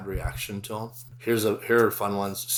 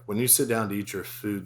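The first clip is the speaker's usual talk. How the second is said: ordinary talk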